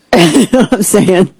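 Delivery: normal talking voice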